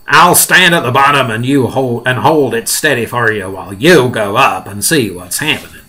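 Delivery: loud officious character voice; police chief's voice. Acted. Police chief suggesting a ladder, loud character voice. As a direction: ask it loudly in character.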